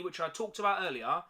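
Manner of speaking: ordinary talk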